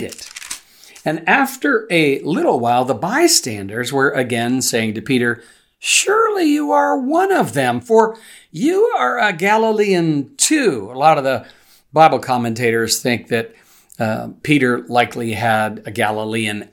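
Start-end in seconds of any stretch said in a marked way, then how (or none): 5.8-10.9 bystanders' voice pitched much higher, accusing. A narrator's reading. Bystanders accusing Peter of being a Galilean, high and insistent. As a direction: point the finger in a high, insistent voice.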